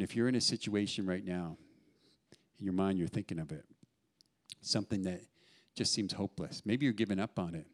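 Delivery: usual speaking voice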